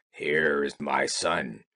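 put-on low croaky old toad; deep scheming toad mother.

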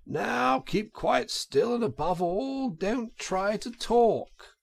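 slow, high, gentle instructions; calm a fainting woman slowly; soothing gentleman. A narrator's reading. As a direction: gentleman telling the washerwoman to rest, slow and gentle. Gentleman voiced in story.

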